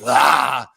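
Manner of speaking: sudden loud "Ah!" to scare; jump scare yell. Retold or imitated. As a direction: burst out shouting "Ah!". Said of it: "blast out of there and be like".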